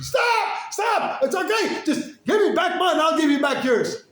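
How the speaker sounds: high, loud shouted plea; woman shouting at the father to stop, high and loud; frantic shout. Retold or imitated. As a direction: yell stop urgently.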